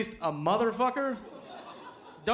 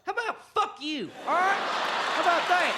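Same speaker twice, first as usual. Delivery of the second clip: higher, snapping at a reporter; mock snapping; 10 semitones higher than usual. Bush snapping at Helen Thomas, mock angry. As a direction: lose your cool in mock fury. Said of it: "just goes".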